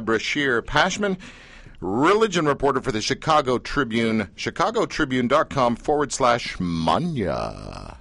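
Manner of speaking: slow, drawn-out web address; slow showman's read of the address; drawn-out announcement. Shown as a demonstration. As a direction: stretch it out like a club announcer. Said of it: "that's how you have to say it".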